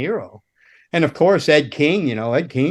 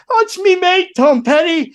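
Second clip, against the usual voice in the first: put-on high-pitched, insistent Scottish accent; Scottish mate pleading his case, pitched well up; hard Scottish accent; 5 dB louder than usual. Friend insisting to the guard, in hard Scottish accent. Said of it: "hard Scottish".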